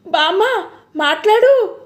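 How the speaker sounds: high, urgent call. A narrator's reading. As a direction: raise the voice to rouse her. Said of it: "కొంచెం గట్టిగా పిలుస్తూ".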